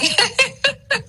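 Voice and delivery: bright high burst of laughter. Real, not acted. Mother laughing at her son's birth question, high laugh. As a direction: let a high laugh break out.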